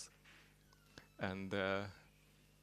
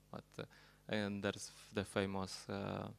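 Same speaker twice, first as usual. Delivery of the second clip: normal talking voice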